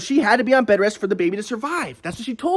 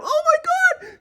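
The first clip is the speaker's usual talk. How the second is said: shrill, much higher crying wail mocking the ex; mock hysterical ex; much slower than usual. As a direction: parody her freaking out, whiny and teary. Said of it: "like a fucking woman will freak out".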